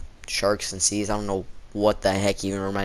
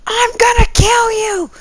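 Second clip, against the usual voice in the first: high, slow, stretched screaming threat; retold threat; 9 dB louder, slower than usual. Kid yelling a threat at the teacher, high drawn-out scream.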